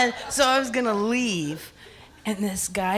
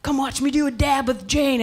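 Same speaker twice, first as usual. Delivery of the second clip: higher-pitched call to a crowd; mock calling out; 5 semitones higher than usual. Guy summons the whole party to watch, mock calling out. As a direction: call everyone over, imitating an excited host.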